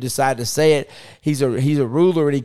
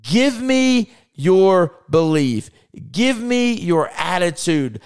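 slow commanding Jesus; 2 semitones higher, slower than usual. Acted. Jesus speaking to Jairus.

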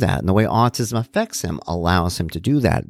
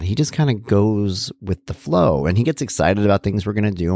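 usual speaking voice

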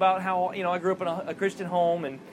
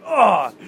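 loud pained grunt; imitated grunt; 2 semitones higher, 10 dB louder than usual. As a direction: grunt as if hit, imitating him. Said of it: "You hear him grunt".

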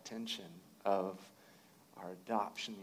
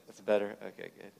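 usual speaking voice

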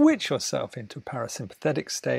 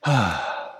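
relieved sigh; 5 semitones lower than usual. Shown as a demonstration. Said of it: "keep an eye out".

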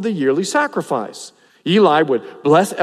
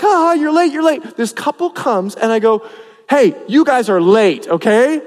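higher, louder mock scolding; teasing greeter; 6 semitones higher, 5 dB louder than usual. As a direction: joke at the latecomers. Teasing latecomers at door.

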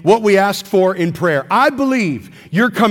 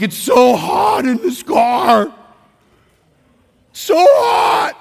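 put-on whiny child complaining, louder; whiny kid; 4 semitones higher, much slower than usual. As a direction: whine like a hot kid in the back seat. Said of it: "loudly in a whiny voice".

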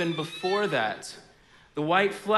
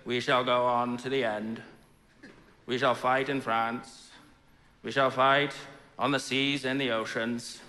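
low, measured Churchill impression; lightly voiced Churchill orating; imitating Churchill; 5 semitones lower than usual. Pastor imitating Churchill's wartime speech, low and measured. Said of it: "It's how he says it".

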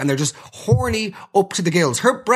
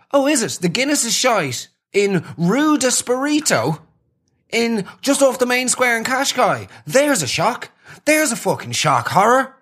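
higher, sarcastic shock horror; sarcastic mockery; 7 semitones higher than usual. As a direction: play it dripping with fake surprise. Sarcastic mock reply to the lads.

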